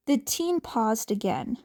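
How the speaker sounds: ordinary delivery, nothing marked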